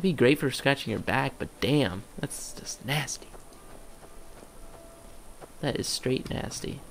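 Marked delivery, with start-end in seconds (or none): 2.2-6.8 slow, whispered disgust; whispered slowly, grossed out. Real, not acted. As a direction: breathe the words out slowly in revulsion. Disgusted aside at monster.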